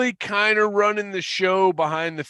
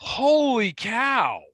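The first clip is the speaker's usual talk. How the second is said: amazed; 7 semitones higher, much slower than usual. Real, not acted. Amazed at a revelation.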